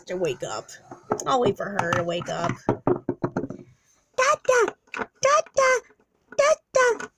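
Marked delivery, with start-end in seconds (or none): 4.2-7.1 put-on very high little baby voice; short, high baby calls; toddler calling Daddy. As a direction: squeak it out like a toddler.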